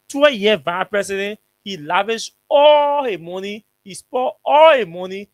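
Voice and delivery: heated rant. Real, not acted. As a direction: hammer each charge home. Ranting about the president.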